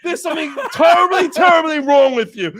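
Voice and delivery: high, loud shocked scolding; mock outrage. Scolding his cohost, high and appalled. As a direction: lean hard on terribly, appalled.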